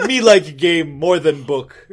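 loud, slow dim-witted brute; mock caveman. Joking that games beat books, loud caveman voice. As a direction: bellow it slowly like a caveman. Self-mocking caveman talk.

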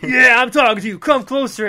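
loud and high, pitching hard; pushy TV ad. Retold or imitated. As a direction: grab the viewer, loud. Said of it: "it's a wrestling ad".

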